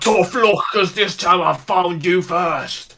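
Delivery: orc voice. Retold or imitated. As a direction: gloat slowly like a vengeful orc. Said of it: "cool, like, voice lines for it".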